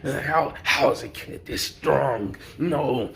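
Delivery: lightly voiced villain, distressed; a hint of a whining villain in disbelief; rattled villain. Storyteller as the outclassed villain, mildly distressed disbelief. Acts villain losing the fight.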